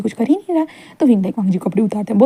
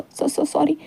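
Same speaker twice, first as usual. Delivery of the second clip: soft, higher, sheepish sorries; meek higher apology; soft sorry; 5 semitones higher, 8 dB quieter, much slower than usual. Acted. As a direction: murmur the apology meekly. Wing apologising.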